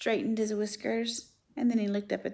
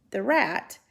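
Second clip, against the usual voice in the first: usual speaking voice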